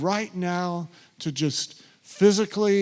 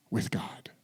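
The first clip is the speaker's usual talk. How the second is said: whisper; 7 semitones lower, 9 dB quieter than usual. Acted. End of Zacchaeus' quoted confession.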